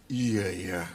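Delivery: low, sinking yeses; deflated agreement. Acted. Priest mocking the holy glow turning to horns, deflated agreement. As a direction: let the yeses drop away low. Mothers' joy gone once back home.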